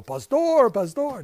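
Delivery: high, loud "Pastor, Pastor"; mock eager greeting. Greeting Ronald at the class, eager mock calling. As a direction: call out eagerly to him. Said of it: "when I go".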